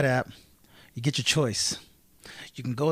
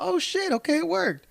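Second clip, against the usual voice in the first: pitched way up, a retold surprised oh shit; pleasantly surprised; 13 semitones higher than usual. Retelling his parking trick working, higher and surprised. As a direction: squeak it out like the trick worked. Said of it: "I was like".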